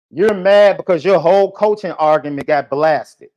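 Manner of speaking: usual speaking voice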